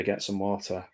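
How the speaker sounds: ordinary talk, nothing marked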